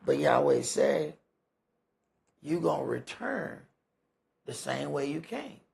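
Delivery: ordinary delivery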